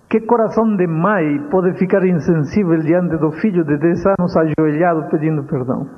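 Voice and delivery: ordinary talk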